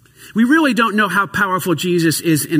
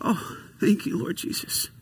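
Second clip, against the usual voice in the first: whispered thanks; 9 dB quieter than usual. Retold or imitated. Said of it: "we went".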